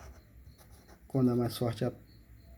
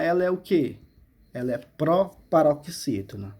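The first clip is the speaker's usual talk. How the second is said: usual speaking voice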